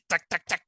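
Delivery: military tack sounds. Retold or imitated. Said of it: "military feeling in your body".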